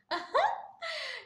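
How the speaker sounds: high, loud real laugh; laughing out loud at the comment; burst of laughter. Real, not acted. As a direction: break into loud giggling. Laughs about Bangal talk.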